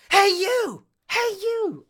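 high, loud shouted call. Retold or imitated. Host imitating Mace's shout from the fight scene, high and loud. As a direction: shout it out to grab attention. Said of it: "there's only one where you can kinda shout".

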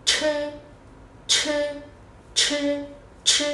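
"ch" thì thầm bật mạnh hơi; "ch" đẩy hết hơi ra, không thanh. Shown as a demonstration. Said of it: "Đọc C H". (phụ âm "ch" bật hơi kiểu tiếng Trung)

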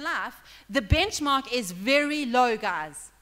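loud, driving sermon delivery; preaching at full force. Acted. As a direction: press the point hard to the congregation.

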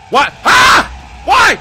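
very high, very loud startled shrieks; panicked screaming. Real, not acted. Player suddenly attacked in the game, panicked screaming. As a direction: scream in shock at full volume.

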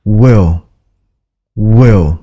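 will said softly with a short falling end; nhẹ nhàng will; soft, light will. Shown as a demonstration. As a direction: say will gently, not punched. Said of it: "các bạn chỉ cần nói".

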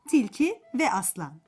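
ordinary delivery, nothing marked

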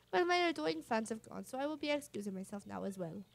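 put-on grand, self-admiring Flavio accent; Flavio's accent. Flavio bidding Captain Mario a pompous farewell, in his accent. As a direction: lecture in Flavio's foreign accent. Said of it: "I've been doing Flavia's accent".

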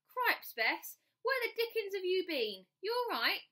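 high, loud, startled cry; alarmed father. Acted. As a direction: shout it down the phone in alarm. Father's alarmed phone reply.

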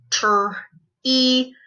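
tr, then e as "ee", separately; sounded out. Shown as a demonstration. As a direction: break tr, e apart with a pause. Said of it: "We're gonna sound them out".

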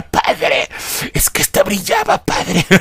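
high whiny cry to the priest; hammed-up tearful plea; Chabela impression. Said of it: "Como la Chabela". (imitando a la Chabela, chillona)

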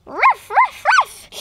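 high, loud puppy yips; yappy dog bark. Pretend. Toy puppy barking to cheer Elsia. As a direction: bark like an excited little dog.

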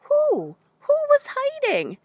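high-pitched questioning bear; bear asking. Retold or imitated. Reader recalls bear's question, high and wondering. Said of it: "Bear was asking".

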